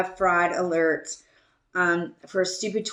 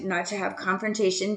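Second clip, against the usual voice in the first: ordinary delivery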